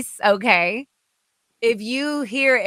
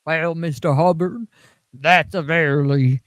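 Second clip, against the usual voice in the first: lower, slow, far-off put-on voice; gross L. Ron Hubbard voice; 4 semitones lower, slower than usual. Doing Hubbard's voice for friends on stream, lower and slow. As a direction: drag it out lower, smug and slow. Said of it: "gross L. Ron Hubbard's voice".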